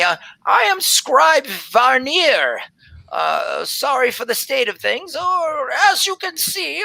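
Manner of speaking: high, fluttery voice of an ancient elven woman; flustered old elf scribe. Acted. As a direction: play a scatterbrained old elf surprised by visitors. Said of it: "she says".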